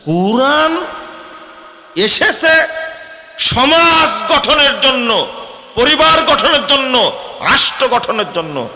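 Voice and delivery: very high, loud and slow sermon climax; preaching at full force. Acted. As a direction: stretch each phrase out at the top of the voice. (ধীরে টেনে টেনে উচ্চস্বরে ওয়াজ)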